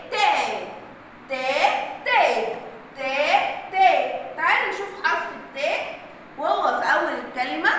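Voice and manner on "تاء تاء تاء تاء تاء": rhythmic phonics repetition of تاء; repeating ta sound. Shown as a demonstration. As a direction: drill the sound تاء over and over for the class. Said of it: "خلى صوته بقى عامل إزاي؟".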